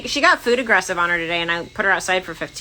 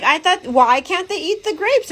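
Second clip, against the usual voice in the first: puzzled Joey; 9 semitones higher than usual. Retold or imitated. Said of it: "He's like".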